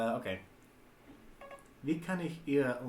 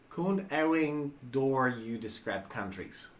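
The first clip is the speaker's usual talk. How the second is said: slow, evenly spaced reading; careful dictation; slower than usual. Real, not acted. Player reading into the translation app, slow and deliberate. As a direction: dictate it slowly for a machine.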